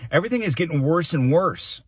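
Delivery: loud, high-pitched alarm; impassioned cry. A narrator's reading. Boy warning the mayor about the city, impassioned cry. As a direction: protest at the top of your voice. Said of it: "he cried".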